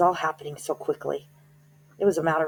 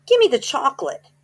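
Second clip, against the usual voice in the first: put-on much higher, louder boy's shout; 10 semitones higher, 8 dB louder than usual. A narrator's reading. Bruno shouting at the witch for his chocolate, high and loud. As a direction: shout the demand like a suspicious kid. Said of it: "shouted Bruno".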